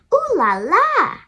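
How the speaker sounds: high, loud, showy ooh la la; a girl thrilled by her fancy dress, squealing; fancy exclamation. Acted. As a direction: sing it out like a posh little diva. Fancy girl's delighted exclamation.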